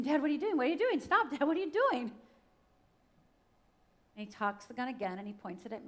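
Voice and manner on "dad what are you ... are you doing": loud, high-pitched frantic yelling; retold panicked scream. Fourteen-year-old screaming at dad with the gun, panicked. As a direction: cry out in alarm, re-enacting the scream. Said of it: "and I start screaming".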